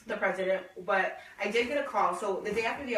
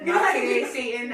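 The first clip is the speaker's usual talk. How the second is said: laughing rebuff; 3 semitones higher, 8 dB louder, slower than usual. Retold or imitated. Coworker retelling her call to friends, laughing loud rebuff. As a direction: belt it out as a joking brush-off.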